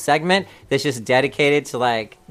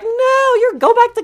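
higher, louder, dismissive nurse imitation; mock dismissive nurse; 6 dB louder than usual. School nurse waving off a sick student, mock dismissive. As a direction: brush the kid off like a careless school nurse. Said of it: "the nurse at the school was like".

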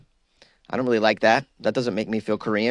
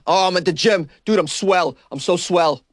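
mock gym bro; 5 semitones higher than usual. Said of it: "We're like".

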